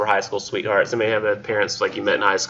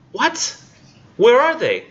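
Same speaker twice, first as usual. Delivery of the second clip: mock alarm; 13 semitones higher, 4 dB louder, slower than usual. Sun panics, lands are gone.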